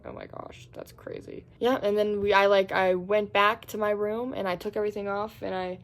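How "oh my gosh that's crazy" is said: hushed whisper, no voice at all. Retold or imitated. Gossiping to Megan about Eli in a whisper. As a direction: breathe it like a secret.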